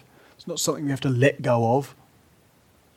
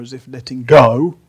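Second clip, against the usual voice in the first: "go" punched, clearly louder than the rest; stress on go; 16 dB louder than usual. Shown as a demonstration. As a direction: land hard and loud on "go" in "letting go". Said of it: "emphasis on the go, please".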